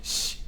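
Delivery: sh as soft unvoiced hiss; soft German ch like sh. Shown as a demonstration. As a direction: breathe a soft sh. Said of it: "the C H sound is really soft".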